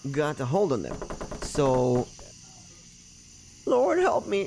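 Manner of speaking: usual speaking voice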